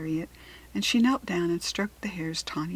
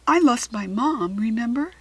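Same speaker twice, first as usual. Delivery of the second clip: hurt anger; 3 semitones higher, 7 dB louder than usual. Acted. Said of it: "she suddenly felt angry".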